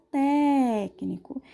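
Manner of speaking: "técnico" with TÉC drawn out; stressed first syllable. Shown as a demonstration. Teacher gives a proparoxytone example, stretched TÉ in "técnico". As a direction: linger on the open TÉ of "técnico". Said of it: "ela é a sílaba".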